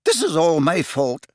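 high, distressed professor; sudden self-blame; sudden outburst. A narrator's reading. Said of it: "said Professor McGonagall suddenly".

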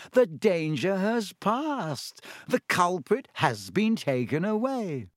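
high, slow, patronizing voice; Lockhart, slow condescension. A narrator's reading. Lockhart lecturing the class, slow and condescending. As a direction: talk down slowly, as to the dim. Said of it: "speaking slowly as though they were all a bit dim".

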